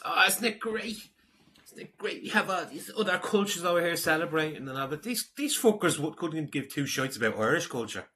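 sarcastic high-pitched mimicry; mocking high voice. Mocking a commenter's attitude.

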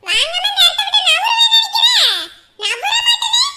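loud, high-pitched protest; defiant shout. Acted. Refusing to budge without food, shouting defiantly. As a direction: dig your heels in at full voice.

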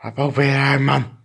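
ordinary delivery

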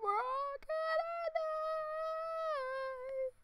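very high, hammed-up cry of doom; mock-panicked wail. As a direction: wail it, stretching the last words out long. Mock panic over helicopter, doom.

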